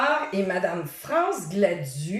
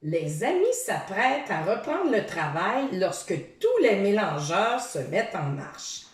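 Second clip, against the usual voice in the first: ordinary delivery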